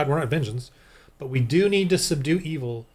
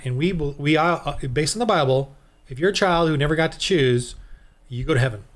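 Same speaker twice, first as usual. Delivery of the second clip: ordinary talk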